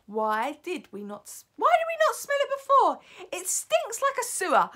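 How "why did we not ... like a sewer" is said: high, loud, agitated witch; high-pitched outcry. Acted. Witches realising the stink was there, crying out high.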